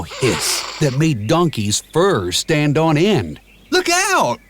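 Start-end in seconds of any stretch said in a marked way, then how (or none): 3.7-4.4 high, sharp shout of warning. A narrator's reading. Donkey warning Shrek of the sword, shouted high. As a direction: yell to warn your friend. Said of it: "Donkey shouted".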